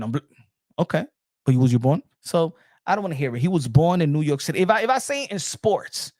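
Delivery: ordinary talk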